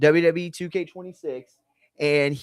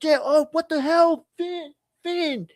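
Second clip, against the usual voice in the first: much higher, startled Irish-less yelp of surprise; startled gamer yelp. Acted. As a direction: jump out of your seat mid-game, high and startled. Dom startled while gaming.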